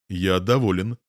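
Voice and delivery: ordinary delivery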